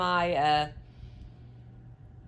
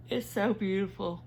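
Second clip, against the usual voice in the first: talking through a yawn. Real, not acted. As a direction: say it mid-yawn. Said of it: "I'm so sorry that I'm, uh, yawning so much".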